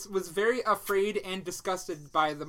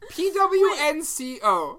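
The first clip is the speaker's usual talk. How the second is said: spelling out; 6 semitones higher, 4 dB louder, slower than usual. Shown as a demonstration. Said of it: "Spell it again".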